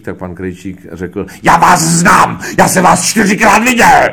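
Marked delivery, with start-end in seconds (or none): 1.4-4.1 high, full-force bellow; hammed-up director roaring an introduction; shouting director. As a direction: greet them by yelling at the top of your voice. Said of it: "Proč na mě křičíte?".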